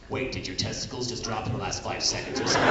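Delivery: ordinary talk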